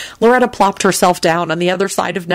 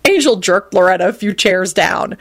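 plain reading voice

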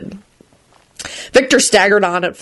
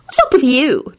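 higher-pitched prying question; nosy demand; 7 semitones higher than usual. A narrator's reading. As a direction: demand it suspiciously. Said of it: "demanded Rosie".

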